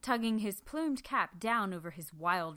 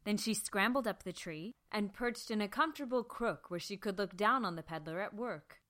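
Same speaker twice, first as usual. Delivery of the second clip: usual speaking voice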